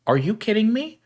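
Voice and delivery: high, disbelieving retort; mock outrage; incredulous. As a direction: act amazed at the nonsense. Retorts to interviewer.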